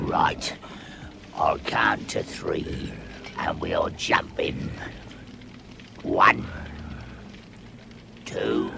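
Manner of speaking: slow hushed whisper; low conspiring whisper, drawn out; whispered plan. Acted. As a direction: whisper the count so the thief can't hear. Trolls plan an ambush.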